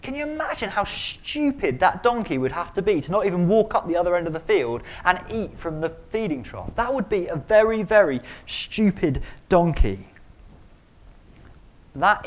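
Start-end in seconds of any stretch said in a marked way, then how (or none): none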